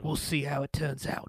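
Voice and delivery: deadpan movie tough guy, lightly done; a hint of an Australian-sounding Statham; Jason Statham impression. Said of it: "I think it's a decent impression".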